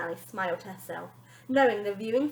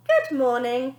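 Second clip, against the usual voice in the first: sneered; 4 semitones higher, 9 dB louder, slower than usual. A narrator's reading. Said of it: "sneered Wonder Nanny".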